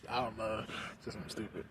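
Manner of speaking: ordinary delivery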